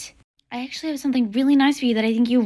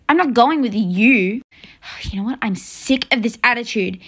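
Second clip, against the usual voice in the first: usual speaking voice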